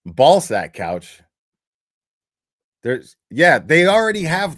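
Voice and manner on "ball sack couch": louder. Shown as a demonstration. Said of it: "louder repeat".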